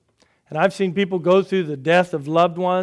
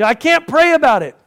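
anguished protest; 10 semitones higher, 9 dB louder than usual. Retold or imitated. Voicing a struggling believer.

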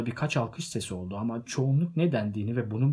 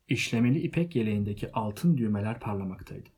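ordinary delivery

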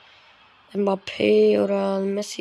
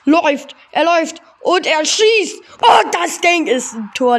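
excited; 4 semitones higher, 5 dB louder than usual. Real, not acted. Live goal commentary.

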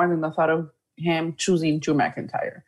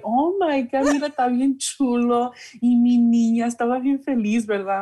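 gushing mom; 4 semitones higher than usual. Retold or imitated. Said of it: "she was like".

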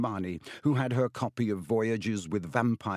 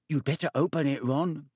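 timid, higher-voiced urging, not truly whispered; 5 semitones higher than usual. A narrator's reading. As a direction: nervously advise a friend. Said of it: "said Neville in a timid whisper".

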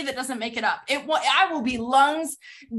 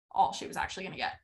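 softer, quicker repeat with stress on all; quiet repeat; 6 semitones lower, 7 dB quieter, faster than usual. Shown as a demonstration. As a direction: say it again under your breath, fast.

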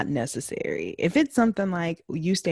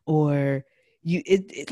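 usual speaking voice

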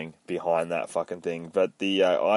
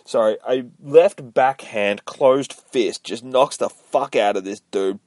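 usual speaking voice

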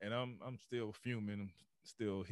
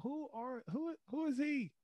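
high, amazed fan voice. Retold or imitated. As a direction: squeak it out like a stunned spectator. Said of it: "people gonna be like".

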